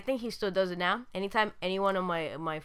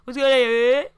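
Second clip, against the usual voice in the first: hype greeting; 4 semitones higher, 10 dB louder than usual. Retold or imitated. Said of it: "It's like".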